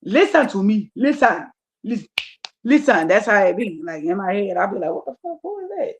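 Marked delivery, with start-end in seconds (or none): 0.0-3.1 insistent African-accented inner voice. Shown as a demonstration. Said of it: "Some of them are African". 4.9-5.9 hushed, high-pitched mock bewilderment; startled inner reaction. Startled reaction to voices in head.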